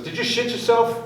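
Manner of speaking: mock-concerned track worker teasing; teasing safety guy. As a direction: poke fun at the driver. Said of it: "He goes".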